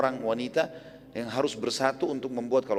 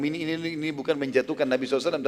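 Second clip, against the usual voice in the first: ordinary talk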